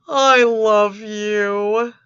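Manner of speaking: loud, very slow, dragged-out love line; mock lovesick drawl. Reading his chat to the girl, loud, slow mock devotion. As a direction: ham up the clingy lover.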